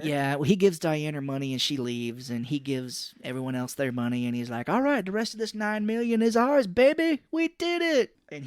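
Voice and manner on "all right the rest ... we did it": much higher, rising victory whoop; hammed-up triumphant dad; mock gloating cheer. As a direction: crow over the haul. Dad celebrating the win.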